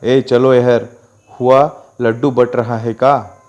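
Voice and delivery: plain reading voice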